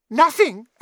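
high, loud mocking poltergeist; gleeful triumphant shout. A narrator's reading. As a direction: yell it in triumph.

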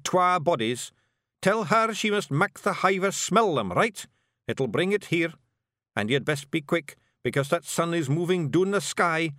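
a wee blue man laying out a grim plan; Scottish Feegle chief. A narrator's reading. Rob gives orders.

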